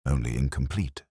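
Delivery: ordinary delivery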